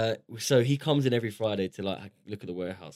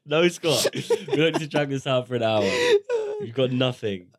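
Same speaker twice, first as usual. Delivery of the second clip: laughing through the words, louder and higher; cracking up while protesting; 5 semitones higher, 6 dB louder, slower than usual. Real, not acted. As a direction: near tears from laughing. Laughing fit at partner.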